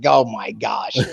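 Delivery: low, slow, dismayed groan of a line; retold exasperated sigh of words; mock exasperation. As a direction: drag it out low in weary disbelief. Dad spots daughter's lit phone.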